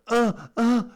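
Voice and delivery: high, loud, slow imitated baby grunt; baby's uh uh grunt. Doctor imitating a baby's wordless grunt for parents, high and drawn out. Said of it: "Uh, that can mean anything".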